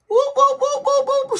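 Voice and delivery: rapid high-pitched celebratory whoops; playful siren-like whooping; high hype whoops. Acted. Hyping character being a firm partner.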